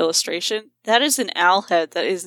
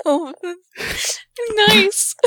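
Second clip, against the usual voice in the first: holding back tears; 11 semitones higher than usual. Retold or imitated. Friend voices herself near tears over the kid, high and choked. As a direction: fight back happy tears. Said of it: "trying not to cry".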